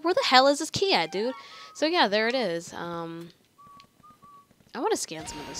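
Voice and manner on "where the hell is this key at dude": higher-pitched mock exasperation; mildly frustrated retold question; retold frustration. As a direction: imitate your younger self fed up with searching. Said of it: "I was always frustrated".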